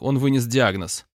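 ordinary delivery, nothing marked

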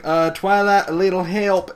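retold urgent appeal; calling for help. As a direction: imitate an overwhelmed friend calling out. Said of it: "and she's like".

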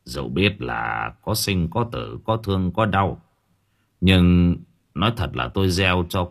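low, softened voice of an elder; gentle old man. A narrator's reading. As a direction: counsel the family gently and gravely. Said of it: "Ông cụ Thân nhẹ nhàng bảo".

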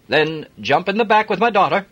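hearty Yorkshire driver; broad Yorkshire accent. Acted. Jim offering Adam a lift, broad Yorkshire accent. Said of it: "broad Yorkshire accent".

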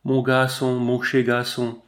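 lightly voiced smitten woman, words drawn out; halting endearments, slightly put on; a hint of a doting foreign woman; broken words. A narrator's reading. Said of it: "des mots entrecoupés".

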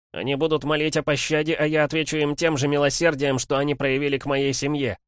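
put-on a boy swearing revenge, grim and high; vengeful boy voice. Мальчик клянётся отомстить. (мальчишеская клятва мести)

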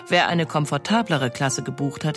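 ordinary talk, nothing marked

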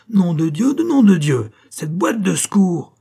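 high, loud cursing, slowing on the final demand; furious yelling. A narrator's reading. Director cursing the missing first-aid box, yelling. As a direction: bellow curses to the whole house. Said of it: "hurla-t-il à la cantonade".

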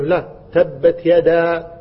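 very slow, firm تبت يدا; slow correction. Retold or imitated. Teacher correcting the child with slow recitation. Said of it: "فقال له".

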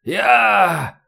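high, strained moan; wounded man's drawn-out moan. A narrator's reading. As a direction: groan it out in pain. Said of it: "простонал полковник".

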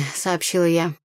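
ordinary delivery, nothing marked

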